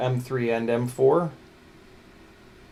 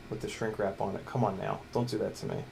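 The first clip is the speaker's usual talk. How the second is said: ordinary talk, nothing marked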